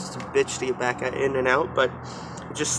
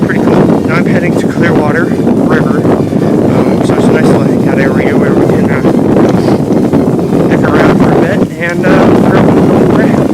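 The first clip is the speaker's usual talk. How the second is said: talking while bouncing over rough ground; on a bumpy road; 13 dB louder than usual. Real, not acted. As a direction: voice jolted by a bumpy ride. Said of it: "Sorry about the bumpy incoming".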